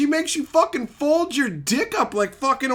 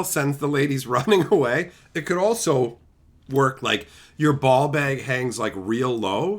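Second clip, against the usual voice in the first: usual speaking voice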